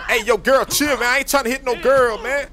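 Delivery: loud, high pleading yell; high yelling to calm the fight; shouting. Acted. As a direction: shout to stop the girl hitting. Brawl, breaking up a fight.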